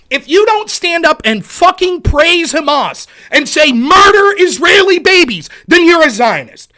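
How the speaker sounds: loud furious ranting; angry rant. Real, not acted. Streamer raging at a critic. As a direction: shout down the critic.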